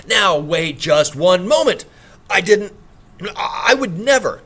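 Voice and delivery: loud, slow, flustered denial; loud flustered protest. Acted. Accused gentleman protesting his innocence, loud and flustered. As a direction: blurt out the denial, rattled.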